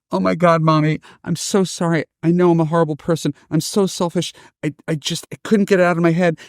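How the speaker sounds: high, fast, gushing teary apology; tearful sister. Retold or imitated. Daughter sobbing apologies to her mom, high and rushed. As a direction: pour it out high like a crying daughter.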